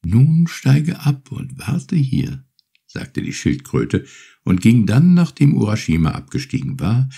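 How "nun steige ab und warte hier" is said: high, gentle turtle voice, unhurried; grateful little turtle. Acted. Turtle thanks Urashima for freeing her, high and gentle. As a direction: offer your thanks like a small polite sea creature.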